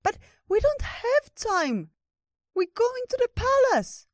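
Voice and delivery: very high, loud panicky squeak; urgent squeaky protest, words stretched; anxious little critter. A narrator's reading. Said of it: "Ratatosk got anxious".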